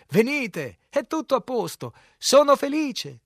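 high, very loud welcome, last words stretched; joyful shout. A narrator's reading. Man on the bank urging the boat to land, joyful shout. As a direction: holler them ashore with great cheer. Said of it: "con un tono di grande allegria".

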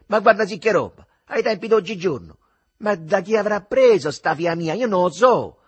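loud, high Roman scolding; indignant tirade. Acted. The mother storms into the kitchen ranting about her daughter, indignant and loud. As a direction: rant with outrage at the daughter. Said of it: "ancora tutta vibrante di sdegno".

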